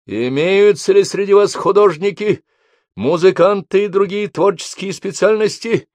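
loud, high call to the ranks. A narrator's reading. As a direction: raise your voice over the parade. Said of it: "возвысил голос Обух".

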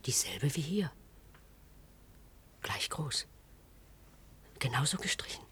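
soft hushed whisper; almost breathless, afraid to be heard. Acted. Whispering to a partner at the open door.

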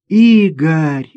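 loud, emotional cry of the name; relieved cry. A narrator's reading. Sister rushes into brother's arms, crying out relieved. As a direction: call out to a loved one just saved.

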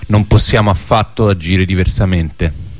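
ordinary talk

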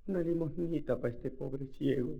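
slow, soft beggar's plea; begging blind man. Acted. Blind beggar at church door asks softly for alms. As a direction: plead softly for a coin.

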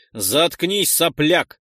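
put-on rough putdown of a young upstart; barking. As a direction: bark it at the kid. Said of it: "рявкнул тот".

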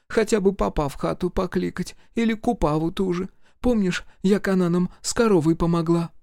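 высокий суетливый голос матери; мать суетится, заглаживая обиду. A narrator's reading. Mother voiced high. (высокий хлопотливый голос матери)

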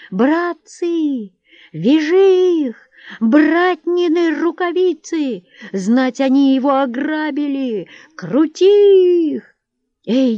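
high, drawn-out rallying yells; urgent tavern hue and cry; crowd shouting. A narrator's reading. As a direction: call out to seize them, high and loud. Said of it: "раздалось со всех сторон в кабаке".

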